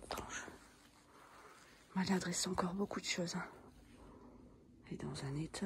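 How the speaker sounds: hushed whisper, barely voiced; library whisper. Real, not acted. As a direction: whisper so nobody hears you. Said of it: "Je parle tout bas". (chuchotement discret)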